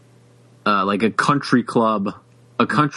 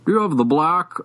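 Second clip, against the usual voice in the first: higher-pitched mock Boston Brahmin accent; imitated upper-class accent; 5 semitones higher than usual. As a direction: imitate a genteel lockjaw-accented gun customer.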